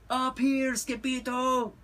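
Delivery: high-pitched Chihuahua call; hollered. A narrator's reading. Chihuahuas calling Skipito from above, hollering high. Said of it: "hollered the Chihuahuas".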